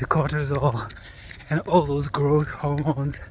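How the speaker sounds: ordinary talk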